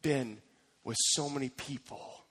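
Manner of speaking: soft, low and halting, with pauses; quietly moved testimony; hushed awe. Acted. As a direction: let it sink almost to nothing, reverently. Visitor quote in hushed awe.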